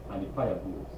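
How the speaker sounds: usual speaking voice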